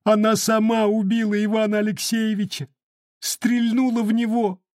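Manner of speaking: high, tearful accusation; indignant tears. Acted. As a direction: sob out the accusation. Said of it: "негодующие слёзы". (гневные слёзы)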